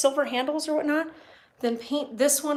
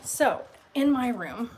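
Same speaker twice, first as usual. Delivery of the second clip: ordinary talk, nothing marked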